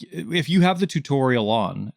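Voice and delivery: ordinary talk, nothing marked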